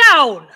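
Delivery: "Down!" shouted, sliding steeply down; big falling command. Shown as a demonstration. Lecturer demonstrating a stern falling interval: loud falling "Down!". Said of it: "if it falls through the intervals".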